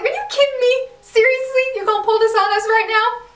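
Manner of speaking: can't believe the show did this; outraged disbelief. Retold or imitated. To viewers about the twin hookup, high incredulous squeal. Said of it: "And I was like".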